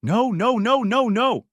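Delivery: high, loud, desperate repeated no; anguished denial. Acted. Caught-out wife to her family, loud anguished no. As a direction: cry it out as her world collapses. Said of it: "she repeated".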